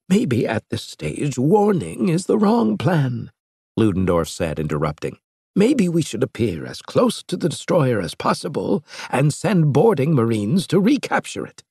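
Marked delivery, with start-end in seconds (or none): 0.1-3.3 slow, higher, musing professor; slow raised voice of the old professor; Ludendorff's voice. A narrator's reading. 5.6-11.6 lightly raised, grave scientist voice; slightly higher, earnest Ludendorff; Ludendorff's voice. A narrator's reading. Ludendorff proposes boarding.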